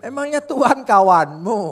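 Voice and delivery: loud laughing question; laughing loudly. Real, not acted. Preacher teasing the congregation, laughing out loud. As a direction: burst out laughing as you ask.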